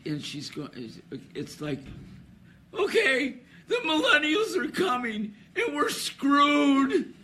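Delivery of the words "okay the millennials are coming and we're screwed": high, loud, hammed-up hysterics; imitating hysterical caller. Host retelling a hysterical woman's complaint-line call, mock hysterical. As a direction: mimic a caller losing it, shrill and loud, stretching the last word. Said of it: "totally incoherent".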